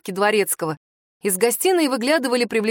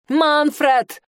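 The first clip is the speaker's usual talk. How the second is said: calling out; 6 semitones higher, 3 dB louder than usual. A narrator's reading. Summoning the butler.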